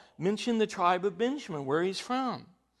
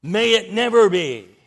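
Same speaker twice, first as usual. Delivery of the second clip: never punched hard and louder; emphatic denial; 10 dB louder than usual. Retold or imitated. Paul answering his readers with an emphatic denial. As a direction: hit never firmly and loudly. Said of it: "very emphatic".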